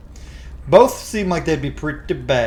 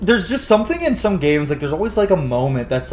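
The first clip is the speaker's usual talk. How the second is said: normal talking voice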